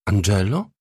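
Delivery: ordinary delivery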